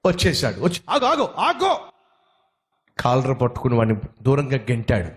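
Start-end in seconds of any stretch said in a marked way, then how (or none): 0.9-1.9 high, slow, drawn-out cry to halt; limping stranger crying out to stop the scuffle; calling stop. Acted. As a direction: stretch each word out high to stop the fight. Stranger breaks up the fight.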